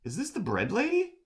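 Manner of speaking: high, rising "is this the bread lady?"; surprised realization. Retold or imitated. Streamer retells recognizing the bread lady, high and surprised. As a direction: catch yourself in sudden recognition. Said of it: "And then I'm like".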